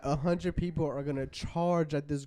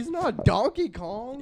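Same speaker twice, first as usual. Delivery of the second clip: exasperated; 6 semitones higher, slower than usual. Real, not acted. Arguing gorilla fight.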